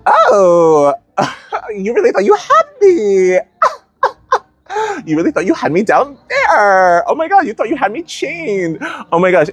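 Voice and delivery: high, laughing mock villain taunt; triumphant high taunt through laughter; triumphant Phoenix laughing. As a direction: gloat as the freed Phoenix, cackling. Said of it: "I'm like".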